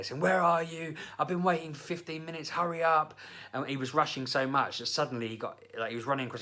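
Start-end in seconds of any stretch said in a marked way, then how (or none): 0.2-3.2 higher, impatient scolding, imitating her; mock berating. Daughter scolding late boyfriend on the phone, mock impatient berating. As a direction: nag him to hurry, in a retold mock scold. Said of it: "berating her boyfriend on the phone".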